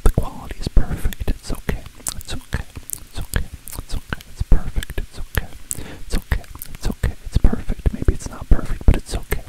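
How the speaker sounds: hushed, soothing whisper. Acted. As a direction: comfort it like a frightened child. Soothing the fixed video file.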